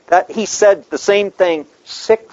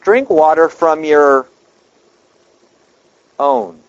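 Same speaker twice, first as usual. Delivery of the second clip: slow, with weight on drink and a long pause before own; slow emphatic reading of Drink water from your own; slowed for emphasis; 4 dB louder, slower than usual. Shown as a demonstration. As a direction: lean on drink, hold, then land own. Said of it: "I want you to watch the emphasis here. Let me slow it down".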